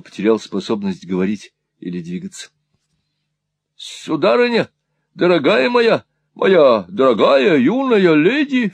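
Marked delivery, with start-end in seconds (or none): 3.8-8.7 high, loud, drawn-out plea in great agitation; agitated exclamation. A narrator's reading. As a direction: cry out, greatly flustered. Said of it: "в сильном волнении восклицал".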